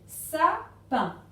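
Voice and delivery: SAPIN slowly split as sa... pin, loud; two strong, separate beats on SAPIN; syllable by syllable. Shown as a demonstration. Teacher giving the second word, SAPIN in slow syllables. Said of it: "autant de cases qu'il y a de syllabes".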